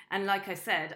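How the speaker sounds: ordinary talk, nothing marked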